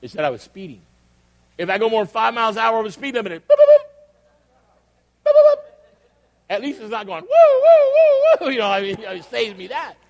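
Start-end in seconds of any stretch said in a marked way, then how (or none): 3.5-5.6 high, loud electronic booping; imitated alert boops. Preacher imitating the speeding warning, high loud boops. 7.2-8.5 high, loud siren wail; imitated siren whoops. Preacher showing what Waze doesn't do, loud siren whoops.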